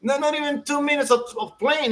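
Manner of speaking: ordinary talk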